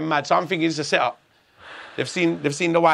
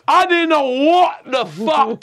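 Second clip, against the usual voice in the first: loud, high, slow exclamation; exasperated outburst; 8 semitones higher, 6 dB louder, slower than usual. Real, not acted. Teller stressing he knew nothing back then, exasperated. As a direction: blurt it out loudly, stretching the words. Recalls total confusion at that moment.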